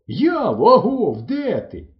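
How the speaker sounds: high, loud hollering; shouted search call. A narrator's reading. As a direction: holler for a lost friend. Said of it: "кричу я на повну губу". (гучно й високо гукає)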